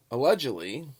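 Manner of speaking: "allegedly" set off between pauses with a lift; emphatic allegedly. Real, not acted. Vlogger qualifying reports for viewers, emphatic allegedly. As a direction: isolate "allegedly" as a caveat. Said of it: "I emphasize the word allegedly".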